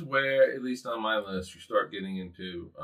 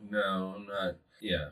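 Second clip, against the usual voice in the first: ordinary talk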